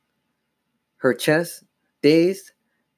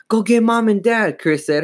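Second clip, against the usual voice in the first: high, urgent cry for help; urgent order; 10 semitones higher, 4 dB louder than usual. A narrator's reading. Chris sending her sister for their parents, urgent. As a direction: send someone running for help.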